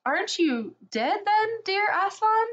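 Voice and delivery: put-on high, timid child's question; little girl voice. A narrator's reading. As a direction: ask like a frightened little girl. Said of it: "said Lucy".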